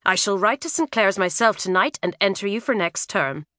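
ordinary delivery, nothing marked

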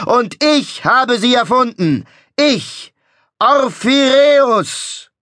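high, loud, slow proclamation; ecstatic showman. A narrator's reading. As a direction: proclaim it like a prophet. Showman proclaiming perpetuum mobile.

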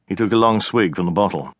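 usual speaking voice

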